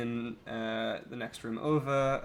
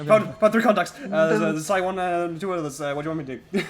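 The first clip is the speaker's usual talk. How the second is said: talking fast; 5 semitones higher, 9 dB louder, faster than usual. Retold or imitated. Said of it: "talking faster than normal".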